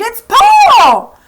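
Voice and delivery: very high, loud scream. Acted. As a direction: scream her brother's name. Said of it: "she screamed".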